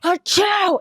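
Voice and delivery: high, sharp fake sneeze. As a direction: mimic a big sneeze. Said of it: "he's like".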